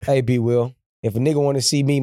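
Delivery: usual speaking voice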